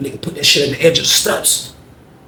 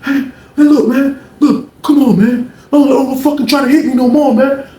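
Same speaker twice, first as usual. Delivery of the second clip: imitating pleading; 10 semitones higher, 4 dB louder than usual.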